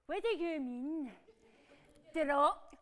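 low, soft, slow disbelief; hushed and dazed; stunned disbelief. Acted. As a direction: let the bad news sink in, voice dropping away. Stunned by bad ratings news.

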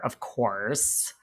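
normal talking voice

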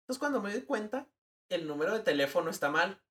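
normal talking voice

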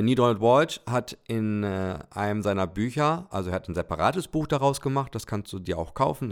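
plain reading voice

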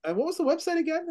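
wife probing about the website, high quick mock voice; imitating wife's question. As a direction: fire the question high and fast like a suspicious wife. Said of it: "She's like".

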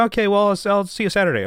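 high-pitched mock phone goodbye; breezy sign-off. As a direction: wrap the call up lightly, pitched high. Acting a hurried call sign-off.

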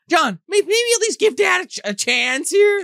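high, loud exasperated plea; hammed-up squeaky appeal; mock pleading. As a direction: plead on Dad's behalf, voice shooting up. Acting a plea to John.

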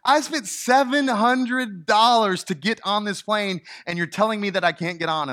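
high, loud, disbelieving protest; indignant traveller retold at a high, loud pitch; outraged protest. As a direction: demand answers from the gate agent. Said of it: "she was completely outraged".

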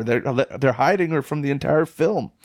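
normal talking voice

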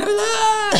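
high mock wail; imitated crying wail. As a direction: cry out like a hurt kid. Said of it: "I'd be crying".

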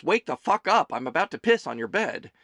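loud, high burst that eases off; mild urgent yelp. A narrator's reading. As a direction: urgently wake someone up, starting high and loud. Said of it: "she started to yelp, which roughly translates".

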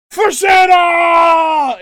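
huge, slow drawn-out shout of For Santa; serious battle cry. Retold or imitated. Cena rallies the crowd with a drawn-out serious battle cry. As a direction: bellow it like a war cry, slowly. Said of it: "most serious tone ... the loudest volume".

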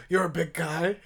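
muffled masked-villain voice; mock supervillain impression; Bane. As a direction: speak as a masked film villain. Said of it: "I can do a Bane".

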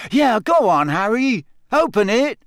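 egging a friend on together; eager crowd. A narrator's reading. Friends urge Harry to open the egg, eager and high.

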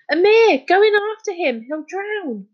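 very high, loud cry for help; high-pitched alarm; panicked shriek. A narrator's reading. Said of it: "shrieked Jazz".

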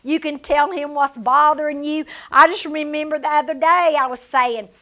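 ordinary talk, nothing marked

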